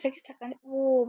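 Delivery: normal talking voice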